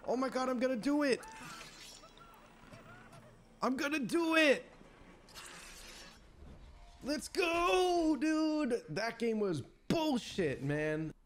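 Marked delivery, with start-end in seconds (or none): 0.1-4.7 excited. Real, not acted. About to win trophy. 7.0-8.8 victory shout. Real, not acted. Victory cheer.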